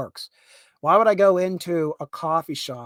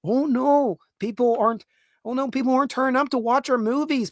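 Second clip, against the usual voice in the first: higher, mock-worried "oh, no"; lightly voiced fake alarm over empty cinemas; mock panicked quote; 6 semitones higher than usual. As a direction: parody the dismayed filmmakers. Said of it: "now they realize".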